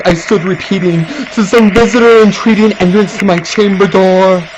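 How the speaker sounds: loud, rising to a fearful cry; full-voiced gothic terror, building; dramatic loud recitation. A narrator's reading. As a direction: declaim it as if terror grips you.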